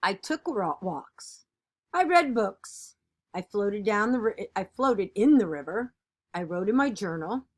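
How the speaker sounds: lively, expressive picture-book reading; animated read-aloud of a kids' book; reading to children. Acted. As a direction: read it like storytime for a class.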